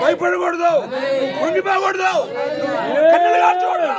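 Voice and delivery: loud, high preaching shout; fiery preacher crying out to the crowd; preaching at full force. Real, not acted. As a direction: thunder it out from the pulpit. Preacher shouting opening lines.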